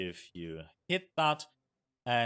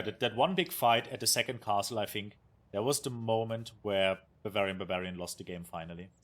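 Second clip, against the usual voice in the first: usual speaking voice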